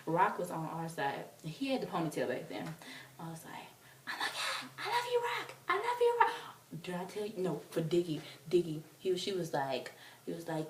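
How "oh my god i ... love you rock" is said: very high, thin reenacted cry; fangirl squeal. Fan calling to Rock beside her, high squeal. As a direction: squeal adoration at your idol.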